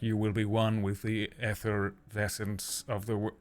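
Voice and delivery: lightly voiced slow villain; a slow, mild hint of a grand villain; a touch of a menacing villain, said slowly; generic villain voice. Shown as a demonstration. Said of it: "my just generic villain voice".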